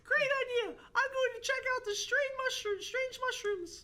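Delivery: very high mock-female voice; put-on falsetto character. Alex off to check out mushrooms, high falsetto. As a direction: squeak it out in falsetto, slowing at the end.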